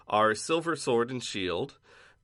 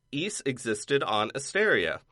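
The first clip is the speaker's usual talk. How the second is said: ordinary talk, nothing marked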